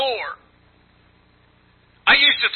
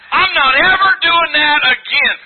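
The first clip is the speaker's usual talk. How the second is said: louder, higher, pleading promise; earnest vow; 5 semitones higher, 4 dB louder than usual. Retold or imitated. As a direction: swear it to the Lord, raised voice. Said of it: "I've said".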